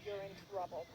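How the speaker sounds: low, soft stern parent; a hushed parental reprimand. Retold or imitated. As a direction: scold quietly as a parent. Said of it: "they were like".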